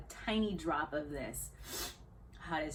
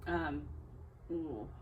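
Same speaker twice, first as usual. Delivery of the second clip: ordinary talk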